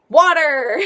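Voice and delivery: loud, bright triumphant reveal; excited answer. Real, not acted. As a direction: blurt out the answer like a game show. Answers her own quiz question.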